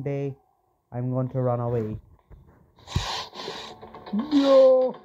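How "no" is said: sudden loud high No; startled shout. Real, not acted. Player caught by Grandma, startled shout. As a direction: yelp it in shock.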